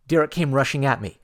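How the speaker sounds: plain reading voice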